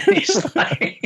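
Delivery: high, giggling attempt to keep talking; helpless laughing. Real, not acted. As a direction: crack up while trying to get it out. Cracking up at his retold joke.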